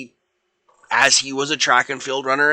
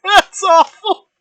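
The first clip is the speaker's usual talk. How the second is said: high, loud squeal of laughing dismay; laughing protest; 10 dB louder than usual. Real, not acted. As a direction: protest loudly through laughter. Laughing reaction to an awful prompt.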